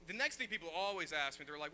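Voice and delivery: usual speaking voice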